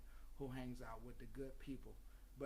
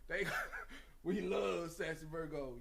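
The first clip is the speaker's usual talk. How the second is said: laughing; 3 semitones higher, 7 dB louder than usual. Real, not acted. Laughing while praising sassy Virgo.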